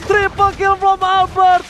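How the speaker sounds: high stretched triple kill shout; fighting game announcer. Real, not acted. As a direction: yell the kill call, drawn out. Caster calls triple kill.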